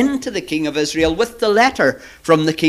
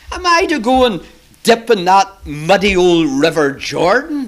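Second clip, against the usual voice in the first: loud, indignant protest; furious indignation; 5 dB louder than usual. Acted. Naaman scorns washing in the muddy river, indignant. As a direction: act out an affronted officer refusing an order. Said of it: "Naaman was absolutely furious".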